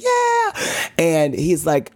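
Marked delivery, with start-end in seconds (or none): 0.0-0.9 very high, drawn-out cheer; cheering crowd. Retold or imitated. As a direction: imitate a studio crowd cheering. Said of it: "the crowd's like".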